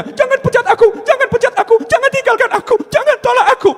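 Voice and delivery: very high mock wailing plea; hammed-up pleading to keep a job; desperate begging. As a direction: beg people frantically, voice far up. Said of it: "maunya ngemis sama manusia".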